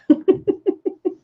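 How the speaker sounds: high, staccato giggle; a real run of high giggles. Real, not acted. Streamer amused by her girl power cheer, giggling.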